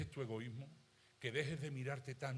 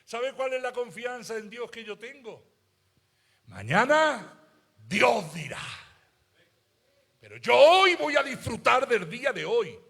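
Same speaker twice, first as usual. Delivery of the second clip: loud, high preaching voice, stretched out; fiery preaching; 9 semitones higher, 15 dB louder than usual. Acted. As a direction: preach it full out to the congregation. Preacher proclaims his trust.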